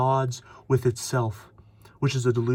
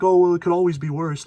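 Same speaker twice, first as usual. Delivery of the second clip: mocking "could be worse"; 5 semitones higher, 6 dB louder than usual. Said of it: "And then they're like".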